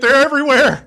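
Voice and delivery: high, loud mock despair; mock exasperated Adam. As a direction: whine it like a cornered fan. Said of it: "Adam's like".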